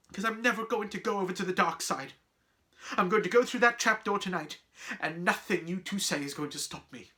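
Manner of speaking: insist, easing down from the outburst; defiant resolve. Acted. Boy telling friends nothing will stop them, defiant.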